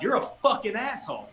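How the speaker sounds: high-pitched, deliberate comeback; retold defiant insult. Guest answering the clerk's dare, retold defiance. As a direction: take up the dare, mildly acting it out.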